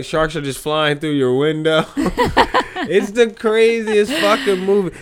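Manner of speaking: high, laughing through words. Real, not acted. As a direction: crack up while talking. Laughing at Sharknado.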